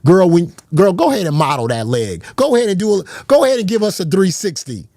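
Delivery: mock hype with a whooping high finish; hammed-up hype girl. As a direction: ham it up like a fan hyping her model walk.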